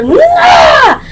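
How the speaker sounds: a sudden, very high shouted "Nah"; retold shout. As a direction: shout the refusal high and hard. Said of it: "I'm over here".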